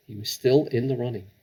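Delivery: ordinary delivery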